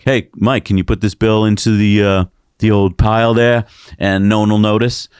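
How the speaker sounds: hammed-up Boston accent; car dealer with a Boston accent. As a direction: put on a Boston buddy voice. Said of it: "they have a Boston accent".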